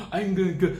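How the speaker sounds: disgusted voice. Pretend. Reacting in disgust.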